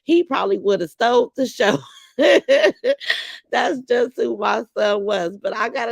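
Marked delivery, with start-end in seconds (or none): 2.2-3.5 loud laugh. Real, not acted. Laughs at son stealing show.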